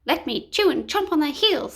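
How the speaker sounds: little dog begging to chomp the baddies' heels; snappy little dog. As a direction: snap it out like an eager pup. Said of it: "snapped the little doggy Woof Woof".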